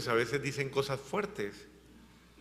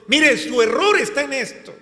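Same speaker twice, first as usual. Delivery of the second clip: loud, raised voice, pressing each point; preached rebuke; 5 semitones higher, 5 dB louder than usual. Acted. Apostle scolding his community, loud preaching rebuke.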